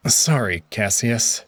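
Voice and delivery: usual speaking voice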